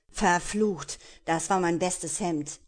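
angry curse. Acted. Pirate curses at Destiny over his bloody shirt, loud and angry. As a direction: grumble loudly over the ruined shirt. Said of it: "Böse funkelte er sie an".

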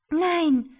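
loud high cry of refusal. A narrator's reading. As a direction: shout it in panic, high. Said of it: "rief Sitari".

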